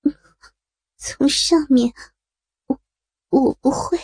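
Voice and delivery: shy, halting reply; coy and bashful. Acted. Woman answers her lover, coy and shy. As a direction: hesitate coyly between the words. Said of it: "孙平娇羞地说".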